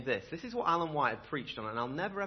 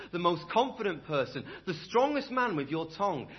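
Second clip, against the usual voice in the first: louder, higher, driving sermon voice; fiery preaching; 5 semitones higher, 4 dB louder than usual. Acted. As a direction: preach it out to the whole church with fire. Preaching at full force.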